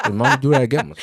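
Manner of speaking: high, loud, laughing through it; words bursting out in laughter. Real, not acted. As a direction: crack up while saying it. Laughing at the kids' comeback.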